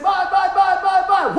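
high, loud sing-song goodbye; playful dad yelling bye; mock shout. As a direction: holler it gleefully, way up high. Dad calling out as bike crashes.

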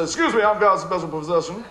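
mock smug, laughing; smug entitlement. Preacher mocking someone cutting in line, smug. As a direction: play a self-important line-cutter, hammed-up.

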